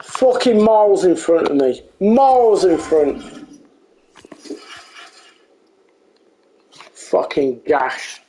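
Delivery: swearing tirade. Real, not acted. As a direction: mutter the curses in disgust. Player muttering after goal.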